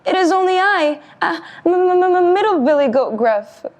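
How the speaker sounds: high, stuttering goat voice; stammering scared goat. Acted. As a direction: stammer out his name in fright. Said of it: "shook so hard he almost fell".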